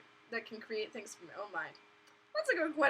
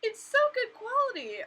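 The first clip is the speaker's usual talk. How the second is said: gushing praise; 13 semitones higher, 7 dB louder than usual. Retold or imitated. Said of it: "I'm like".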